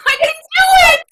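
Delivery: triumphant shout. Acted. Student bursts out proudly to her scene partner, shouting. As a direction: shout it out like you just won.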